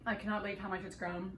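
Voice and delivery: normal talking voice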